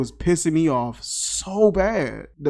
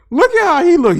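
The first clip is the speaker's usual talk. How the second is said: high, loud cry through laughter; real amused outburst; 13 semitones higher, 9 dB louder, faster than usual. Real, not acted. Reviewer cracking up at the dog's look, laughing high and loud. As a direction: burst out laughing and squeal the words.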